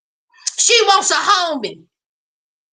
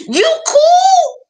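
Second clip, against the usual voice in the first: incredulous check; 10 semitones higher, 4 dB louder than usual. Retold or imitated. Brother checking with Danny.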